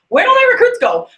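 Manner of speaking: high, loud puzzled cry; bewildered cry. Retold or imitated. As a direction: call out confused.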